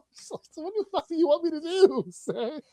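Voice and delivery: ordinary talk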